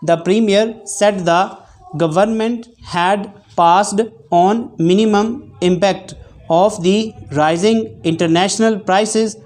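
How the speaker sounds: measured dictation read with spoken punctuation. Shown as a demonstration. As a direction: read each phrase evenly for shorthand students. Reads timed dictation aloud. (Dictation पढ़ने का अंदाज़)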